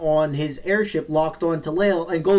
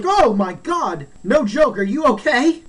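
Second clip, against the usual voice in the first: raised-pitch worried exclamation; acted alarm; 5 semitones higher, 3 dB louder than usual. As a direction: act out alarm over a hurt friend. Retold alarm at scattered friend.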